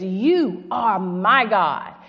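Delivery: each word drawn out slowly; slow scripture quote. Acted. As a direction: let the prayer sink in, slowly. Quoting David's prayer slowly.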